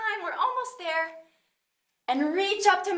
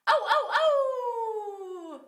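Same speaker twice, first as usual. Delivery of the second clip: stretchy oh sounds; 7 semitones higher, much slower than usual. Shown as a demonstration. Upward dog pose stretch sound.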